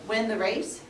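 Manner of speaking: plain reading voice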